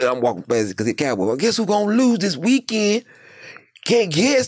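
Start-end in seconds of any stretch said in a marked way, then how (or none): 1.4-4.5 high teasing sing-song; taunting. Retold or imitated. Teasing the Dallas fan, high taunting voice. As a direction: needle him up high.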